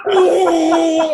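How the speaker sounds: a big startled yelp, mock-performed; loud startled oh. Stranger grabs the wrong man, loud startled oh. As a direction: overplay the lady's shock. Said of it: "Like that".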